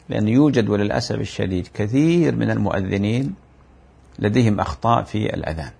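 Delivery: ordinary delivery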